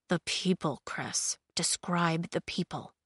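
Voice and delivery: soft, low murmured prompt. A narrator's reading. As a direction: murmur it low, just for a companion. Thorn murmurs instructions to Cress.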